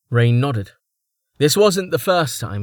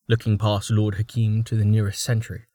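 usual speaking voice

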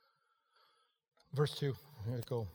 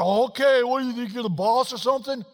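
acted retort; 8 semitones higher, 8 dB louder than usual. Acted retort in household quarrel.

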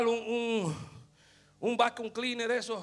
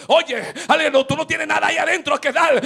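loud, higher, ecstatic sermon climax; shouted preaching; 9 semitones higher, 13 dB louder than usual. Acted. Preacher telling the church God sees the seed, loud ecstatic preaching. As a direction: cry it out, stretching the questions slowly.

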